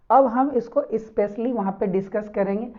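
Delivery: normal talking voice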